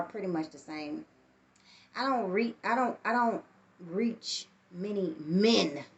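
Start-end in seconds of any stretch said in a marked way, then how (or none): none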